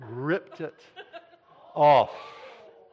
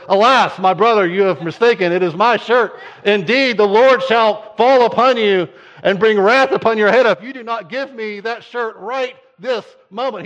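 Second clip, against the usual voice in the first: loud, higher, hammed-up prophetic rant; mock-biblical outrage; 7 semitones higher, 12 dB louder than usual. Kid demanding his shirt back, loud mock-prophetic shout. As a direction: thunder divine wrath over a T-shirt. Childhood shirt quarrel, own voice.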